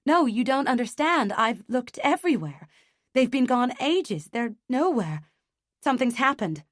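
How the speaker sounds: panicked. A narrator's reading. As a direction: plead in rising panic. Panicked about missing baby.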